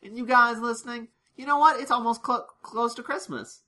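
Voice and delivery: high, bright hosting voice; camera-address parody, pitched high; mock host greeting.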